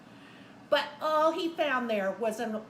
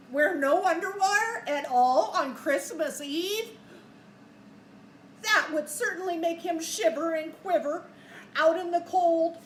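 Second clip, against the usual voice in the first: dramatic read-aloud; 5 semitones higher, 7 dB louder than usual. Acted.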